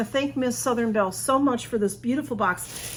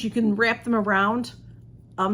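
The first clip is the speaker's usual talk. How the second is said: ordinary talk, nothing marked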